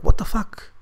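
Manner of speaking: high-pitched startled question; shocked waking. Retold or imitated. Woke up from a slap, shocked retort. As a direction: blurt it out, jolted awake. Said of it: "I just woke up in a shock".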